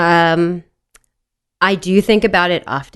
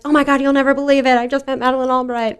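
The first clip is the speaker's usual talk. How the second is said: retold excited gush; 7 semitones higher, faster than usual. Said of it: "I was like".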